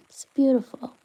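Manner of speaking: soft whispered compliment; whispering. Real, not acted. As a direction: whisper it tenderly. Unvoiced compliment to viewer.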